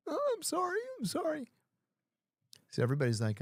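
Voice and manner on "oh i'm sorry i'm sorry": mock high squeaky apology, much higher; hammed-up terrified whimper of sorry; scared apology. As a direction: ham up a frightened pushover begging off. Mocking the scared inmate.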